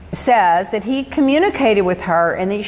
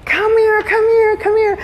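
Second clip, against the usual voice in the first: retold excited call; 12 semitones higher than usual. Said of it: "He's going".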